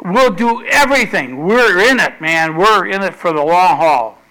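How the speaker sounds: loud, high enthusiastic shout; crowd shouting. Acted. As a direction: shout it like a fired-up crowd pledging loyalty. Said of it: "all the people shouted".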